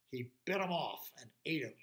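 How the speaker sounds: plain reading voice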